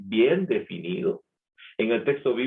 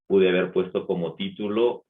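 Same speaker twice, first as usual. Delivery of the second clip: ordinary talk, nothing marked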